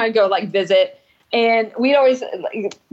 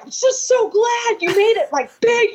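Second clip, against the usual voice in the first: mock yelling; 11 semitones higher than usual. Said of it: "he's just, like, yelling".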